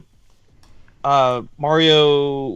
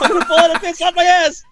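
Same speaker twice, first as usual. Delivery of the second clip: high, loud mock panic; screaming; 13 semitones higher, 6 dB louder than usual. Player about to fall begs teammate, screaming. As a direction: yell for help, pitched way up. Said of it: "like, screaming".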